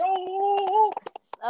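high fluttery mock fuss; hammed-up high "oh, oh, oh"; mock fussing crowd. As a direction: flap it high like gossiping people. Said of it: "He ain't want everybody to be".

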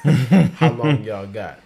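laughing while asking; chuckling through the question. Real, not acted. Joking about long list.

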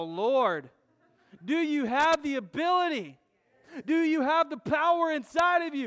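loud, driving sermon climax; full-force preaching. Acted. Preacher rallying the congregation, loud and fervent. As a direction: build and preach it out loud to the room.

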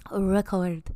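whispered single word. Real, not acted. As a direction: breathe the word out. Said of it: "They're long".

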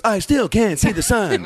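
high, slow, halting impression; Shatner. Host imitating Shatner for laughs, high slow hammy delivery. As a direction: stretch it out in mock drama. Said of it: "And then Shatner goes".

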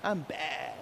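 low, quiet swagger; soft, low understated boast; cocky eagle. Retold or imitated. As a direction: imitate a cool, cocky bird. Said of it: "and go".

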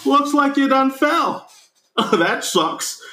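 high-pitched mock librarian voice; Christopher Lloyd. Librarian greets the fallen boy, high mock sympathy. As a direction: pitch it way up, drawling it out. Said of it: "Christopher Lloyd, is just like".

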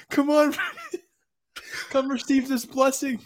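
high, laughing come-on; coax, half laughing; luring friend. Retold or imitated. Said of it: "he's like".

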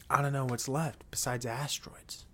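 soft unvoiced whisper. Real, not acted. Speaker wonders aloud about world events, whispering. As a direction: drop to a whisper. Muttered aside.

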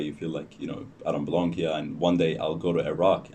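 normal talking voice

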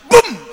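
loud, high "boom"; explosive punch sound effect; imitated punch impact. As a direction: burst it out like a blow landing.

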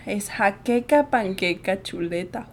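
slow, low and soft; quiet rehearsal. Real, not acted. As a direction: murmur the words one by one. Rehearsing the words before racing.

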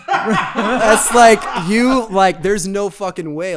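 loud amused refusal; incredulous laughing outburst. Real, not acted. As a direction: blurt it out through laughter, no way.

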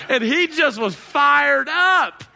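loud, slow, fired-up shout; excited shout. Real, not acted. Pastor telling the church about a fired-up worshipper, excited shout. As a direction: shout it out with joy.

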